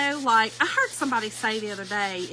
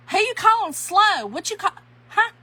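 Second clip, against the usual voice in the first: higher, louder mock outrage; indignant retort; 10 semitones higher, 8 dB louder than usual. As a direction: snap back, offended. Retold quarrel over slow.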